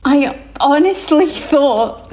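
stifling a laugh mid-sentence; words breaking into giggles. Real, not acted. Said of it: "I'm trying not to laugh".

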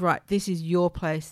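normal talking voice

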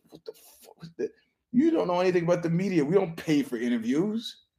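hushed start, then a quick, high mock retort; Joe Rogan. Rogan answering a guest's money demand, lightly imitated disbelief.

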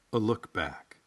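ordinary talk, nothing marked

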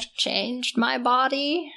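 slow, creepy crone voice; a hint of a withered hag; old crone voice. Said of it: "my withered old crone voice".

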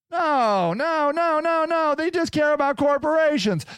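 heated no no. Real, not acted. Heated denial rant.

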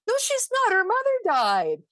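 re-enacted protest, high and exasperated; retold yelling at TV. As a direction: argue back at the television, voice jumping up. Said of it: "I'm yelling at the T V".

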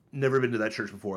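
ordinary delivery

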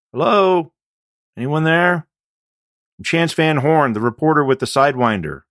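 high, loud call into an empty building; loud, hesitant hello into the dark. Acted. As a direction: call out so someone far inside hears. Calling into empty bar.

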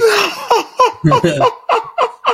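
long high laugh; real laugh. Real, not acted. Mother recalling her kids' denial, laughs high and long.

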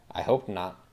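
plain reading voice

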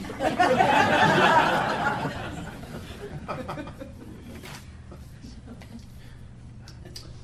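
long breathless fit of laughter; long airy laugh. Real, not acted. Long laugh after remark.

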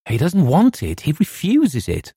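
high, slow angry retort; angry reply. A narrator's reading. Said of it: "I answered angrily".

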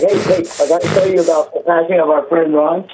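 muffled speakerphone sound; distant, tinny phone audio; bad phone line. Real, not acted. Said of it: "You sound awful".

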